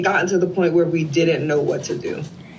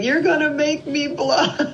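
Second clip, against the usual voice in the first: high-pitched, laughing imitation; playful mock bashfulness; 11 semitones higher than usual. Man reacting to her tears at meeting, laughing bashfulness. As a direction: tease it out high, through a laugh.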